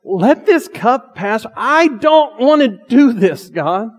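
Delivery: loud, high, drawn-out plea; anguished prayer. Retold or imitated. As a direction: plead slowly and forcefully, pitch pushed up. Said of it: "Do you see how he prayed".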